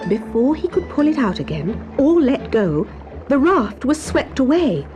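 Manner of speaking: ordinary delivery, nothing marked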